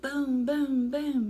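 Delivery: loud booming; thunder boom. Pretend. Reading to a child, booming loud like thunder. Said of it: "Mister Brown makes thunder".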